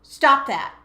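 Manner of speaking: loud, commanding and corrective; sharp parental scolding; stern parent voice. Shown as a demonstration. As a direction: bark orders like a cross parent. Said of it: "what we call the parent voice".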